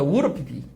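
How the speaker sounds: "우르 피피" said low with would've as a quick ur; reduced would have. Shown as a demonstration. Teacher drilling students on reduced would have. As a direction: model "우르 피피" clipped and fast. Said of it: "한 번 더".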